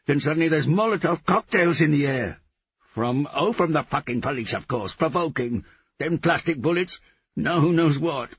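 high, loud furious rant; angry tirade. A narrator's reading. Gonja blaming the police for the trouble, shouting angrily. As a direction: rant at them, shouting throughout.

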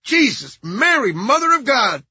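loud, high shocked oath; frightened man's high outburst; high-pitched nasal fright. A narrator's reading. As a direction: exclaim in nasal, shaken alarm. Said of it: "High-pitched, nasal voice".

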